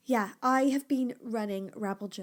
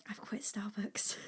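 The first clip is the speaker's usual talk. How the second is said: much softer, breathy confession; emotional whisper; 7 dB quieter than usual. Real, not acted. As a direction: whisper the big news, overwhelmed. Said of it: "I'm getting emotional".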